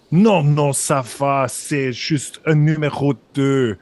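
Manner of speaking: French spoken with a put-on German accent. Impostor answering the guard on the radio, German accent. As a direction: cover up calmly in a German accent. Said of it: "il parle en français avec un accent allemand".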